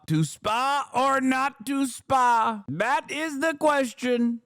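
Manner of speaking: slow, high, grandly declaimed Hamlet line; mock grand Trump. Streamer mocks Trump with a slow, high theatrical Hamlet line. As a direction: ham it up like a Shakespeare actor.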